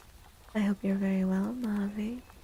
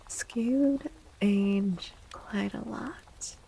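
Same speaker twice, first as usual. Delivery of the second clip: ASMR whisper; slower than usual. Acted. Streamer musing to chat about age in a whisper. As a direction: muse on it in a lingering whisper.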